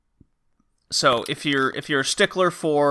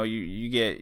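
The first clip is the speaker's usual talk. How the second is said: ordinary talk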